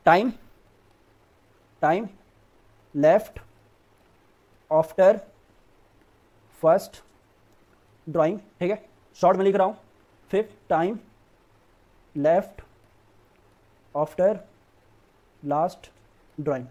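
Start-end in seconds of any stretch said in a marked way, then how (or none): 0.1-8.4 long pauses between words as you write; spaced-out dictation, word by word. Real, not acted. Dictating formula while writing it. 10.3-16.6 slow, spaced-out formula dictation; word-by-word dictation. Real, not acted. Dictating formula while writing it.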